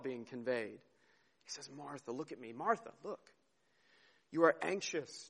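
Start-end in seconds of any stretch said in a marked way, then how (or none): 1.8-5.3 soft, personal appeal to Martha; tender, heartfelt. Acted. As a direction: gently urge her to look. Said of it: "there's something very personal, something very heartfelt".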